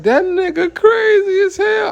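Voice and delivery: high squealing shout, imitating the women; mimicking shrieking women. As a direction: shriek it like the shocked ladies in wheelchairs. Wheelchair women react, mimicked.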